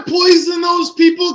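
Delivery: loud, incredulous yell; angry shout. Real, not acted. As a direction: shout it at him in disbelief. Arguing at AJ on stream.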